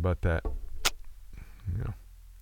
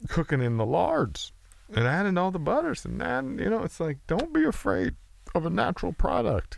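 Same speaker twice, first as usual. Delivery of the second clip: high-pitched mock culinary adviser; exaggerated, high put-on delivery; put-on cook character; 11 semitones higher than usual. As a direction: lecture on cooking in a silly high voice.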